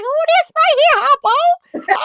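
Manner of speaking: amazed exclaim. Retold or imitated. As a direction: act stunned by her entrance. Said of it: "I'm like".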